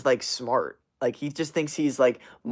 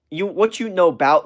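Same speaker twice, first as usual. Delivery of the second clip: mocking texting slang, higher and louder; mock slangy challenge, pitched up; texting-speak imitation; 6 semitones higher, 6 dB louder than usual. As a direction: imitate someone talking in text-speak. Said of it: "in that texting way that's so weird".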